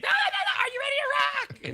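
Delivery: insist, shouting it out again; insistent rock shout. Retold or imitated. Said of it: "And [name] said".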